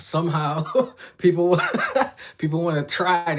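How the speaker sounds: can't believe it, cracking up; amused disbelief. Real, not acted. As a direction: say it through a laugh, stretched out. Amused that people volunteer.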